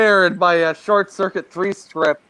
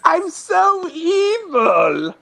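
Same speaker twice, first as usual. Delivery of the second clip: very high, drawn-out villain voice; cartoon baddie, stretched and squeaked up high; evil banker; 11 semitones higher, much slower than usual. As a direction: wail it slowly as a hammed-up villain. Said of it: "if he talked like that".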